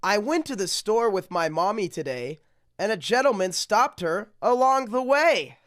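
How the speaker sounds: sing-song rhyming read-aloud; storybook reading. Acted. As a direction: read it like a bedtime picture book. Reads rhyming picture book aloud.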